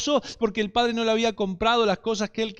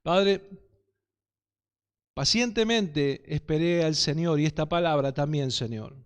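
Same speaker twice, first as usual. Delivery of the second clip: prayer; 3 semitones lower than usual. Real, not acted. Opens a prayer.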